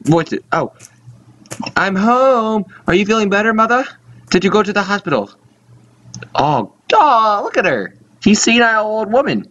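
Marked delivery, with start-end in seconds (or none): 1.8-9.4 somewhat higher son character; lightly voiced dutiful son. Son coming home to ailing mother, lightly voiced. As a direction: greet mother in a light put-on voice. Reads the son's game dialogue.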